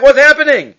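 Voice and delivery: high, loud frightened cry; scared servant. Retold or imitated. Servant seeing army surround them, frightened high cry. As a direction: gasp in fear at the army. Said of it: "He's scared".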